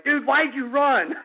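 high-pitched mock disbelief; retold incredulous question. Teller asks his hiding buddy, mock incredulous. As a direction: ask your pal in exasperation, retold.

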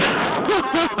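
high-pitched taunting laugh; high derisive cackle; mocking laugh. Real, not acted. Taunting the person on phone.

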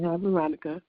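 ordinary talk

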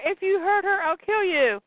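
high-pitched mock threat from the daughter; protective threat. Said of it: "And she says".

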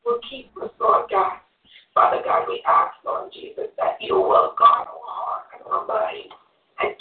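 fervent, earnest congregational prayer; closing prayer. Real, not acted. Woman closing the Bible study in prayer, earnest prayer. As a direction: pray it aloud, calling on God phrase by phrase.

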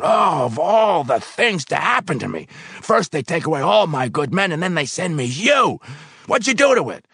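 high, loud exasperated boss; exasperated rant. Acted. Foreman ranting at the useless new worker. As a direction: rant at your bad luck.

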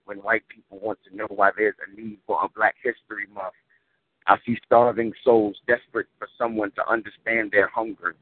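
rhythmic, weighty poem recital; spoken word poetry. Acted. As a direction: deliver it like a slam poem.

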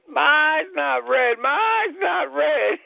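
wide-eyed thrilled repetition; astonished repeat. Real, not acted. Amazed at unreddened eye.

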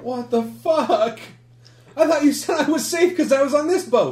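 outraged, betrayed squeal; panicked Gil. Acted. Gil yelling at his friend after seeing the squid, high panicked squeal. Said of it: "And Gil is like".

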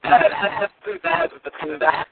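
bad Bluetooth line, drowned sound; underwater phone voice. Real, not acted. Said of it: "I don't understand a word you're saying".

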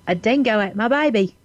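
Australian accent slipping towards Cockney; attempted Australian accent. Shown as a demonstration. Guest reluctantly trying the hosts' test line, amateur Australian accent. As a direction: have a shaky go at an Australian accent. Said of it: "It's almost a little Cockney".

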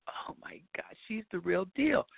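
stunned, whispered aside; awed whisper. Retold or imitated. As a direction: whisper it in disbelief. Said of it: "And I'm like".

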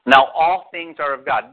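slow scripture quote. Real, not acted. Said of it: "what does the beginning of verse eighteen say".

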